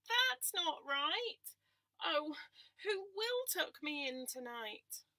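whiny lamb. Acted. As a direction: drag it out like an upset lamb. Lamb complains again.

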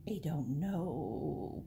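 low, slow, soft cat voice; purring cat. A narrator's reading. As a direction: draw it out low and soft, like a purr. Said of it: "purred the cat".

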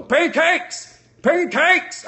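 high, loud yell of pancakes; gleeful shouted catchphrase. Acted. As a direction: belt out Pancakes like a battle cry. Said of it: "that's catching on".

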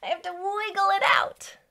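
high, bubbly and quick; giddy wiggle chant for kids; excited wiggle. Shown as a demonstration. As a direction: bounce the words up high with excitement. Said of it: "I get so excited".

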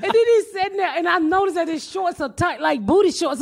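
normal talking voice

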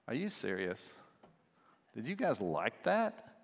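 low, quiet disbelief, mimicking the wives; unimpressed wives. Wives to husbands after a silly movie, mock unimpressed. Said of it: "her eyes are rolling back in her head".

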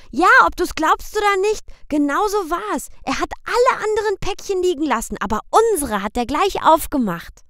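put-on high, fast excited child; eager children. A narrator's reading. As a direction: tumble out the story like a thrilled kid. Boy Michel tells proudly.

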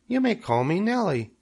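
high, pleased little voice; third little spider. A narrator's reading. Third spider accepts her name, high little voice. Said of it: "said the third spider".

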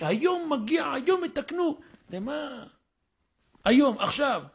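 high, loud, emphatic insistence; insistent. Retold or imitated. Son insists the technician comes today, emphatic. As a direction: hammer home that it's today.

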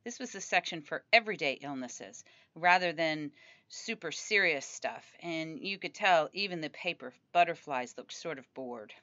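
plain reading voice

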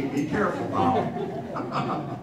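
slow, drawn-out playful warning with a laugh; teasing warning. Real, not acted. Pastor teasingly warns Vicky in the congregation, slow and laughing. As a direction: tease her, slowly and slyly.

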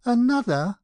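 high, loud astonished outburst; startled exclamation, pitched right up; exclaiming. A narrator's reading. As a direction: cry out in disbelief. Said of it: "I could but exclaim".

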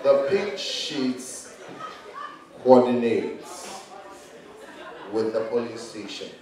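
very slow, low, half-asleep drone; sleepy slow Bawumia. Retold or imitated. Comedian imitating Bawumia at the petition hearing, sleepy and slow. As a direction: stretch every word like he just woke. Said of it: "Bawumia always talks like he just woke up from sleep".

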